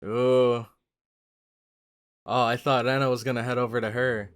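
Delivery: usual speaking voice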